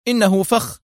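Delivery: high urgent cry; shouting warning. A narrator's reading. Hossam shouting a warning to Mona. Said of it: "هتف".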